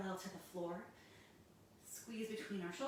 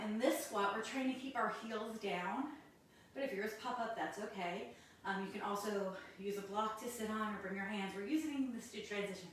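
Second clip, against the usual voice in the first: ordinary delivery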